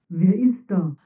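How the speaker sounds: a hint of a hollow tin voice; lightly voiced armor calling into the dark; tinny voice. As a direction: call out like a metal suit. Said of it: "ertönte eine blecherne Stimme".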